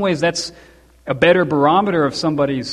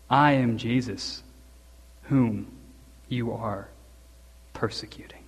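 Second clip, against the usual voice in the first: soft, fading to a whisper; Jesus answering Saul, hushed to a whisper; 7 semitones lower, 10 dB quieter than usual. Retold or imitated. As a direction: say it low and solemn.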